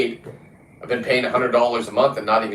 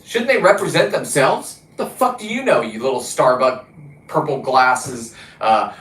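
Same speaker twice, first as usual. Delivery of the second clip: mocking angry rant; 2 semitones higher, 3 dB louder than usual. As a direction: tear into her with mock outrage. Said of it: "I'm like".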